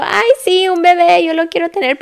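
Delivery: high, loud gushing voice; mock your past self gushing over babies; sing-song, exaggerated eagerness; mock naive enthusiasm. Mocks her own naive past thought.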